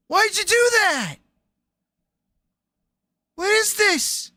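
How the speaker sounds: high, loud disbelieving shout; scandalised high shout; shocked outburst. Real, not acted. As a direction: yell at the menu in disbelief. Outraged at the drink menu.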